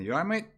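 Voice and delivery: "You all right, mate" high, rising on "mate"; question tune. Shown as a demonstration. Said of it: "You can ask it as a question".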